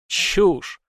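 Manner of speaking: loud, high dismissive burst; scornful snort. A narrator's reading. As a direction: snort the word with contempt. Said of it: "фыркнул полковник".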